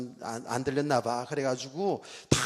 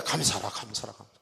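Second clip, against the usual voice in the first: lower, falling repeated command, lightly acted; echoing inner voice; 5 semitones lower than usual. God's word resounding in the preacher's heart, echoing. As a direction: let each repeat drop like an echo. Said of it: "이게 막 울림이 막 오는 거야".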